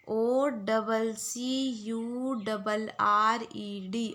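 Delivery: letter by letter, very slow; slow spelling out. Shown as a demonstration. Teacher spelling a word slowly for the class. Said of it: "आप लोग बार बार repeat कर लो".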